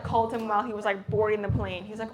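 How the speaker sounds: ordinary talk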